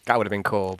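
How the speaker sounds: normal talking voice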